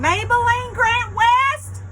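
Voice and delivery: high, loud, slow swaggering call. Acted. Dottie catching the kissing couple, a swaggering high call. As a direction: strut in and call the full name. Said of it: "Dottie swaggered".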